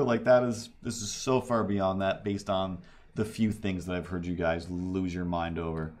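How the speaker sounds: ordinary talk, nothing marked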